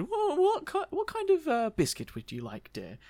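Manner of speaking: put-on high, quavering old lady asking. Margaret asks John about biscuits, high old-lady voice. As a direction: push it up into a granny voice. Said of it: "and she said".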